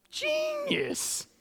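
high, drawn-out marveling; marveling at the verse; awed exclamation. Real, not acted. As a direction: gasp the word in delighted awe. Marvels at the passage.

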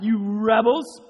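loud, high shout; shouted rebuke. Acted. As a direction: yell at the rebels. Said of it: "he shouted".

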